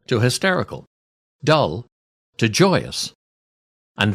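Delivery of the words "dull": ordinary talk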